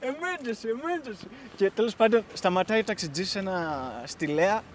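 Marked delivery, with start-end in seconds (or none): 0.0-1.3 high but hushed mock alarm; imitated yell kept small and soft; retold shouting. Passenger calling to taxi driver, soft mock yell. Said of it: "Και φώναζε ο Άμις".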